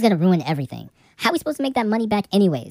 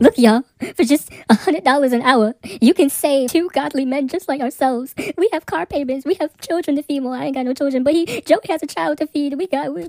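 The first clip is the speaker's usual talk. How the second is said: pleading pitch; 5 semitones higher than usual. Acted. Begging viewers for money.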